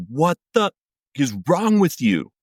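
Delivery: mock outrage, pitched well up; retold exasperation. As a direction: act out a friend's dismayed confrontation. Friend confronts him over the beer.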